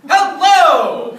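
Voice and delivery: perky game show announcer shout; booming prize announcer. Retold or imitated. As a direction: blast it like a hyped announcer. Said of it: "an extremely, almost violent, perky voice".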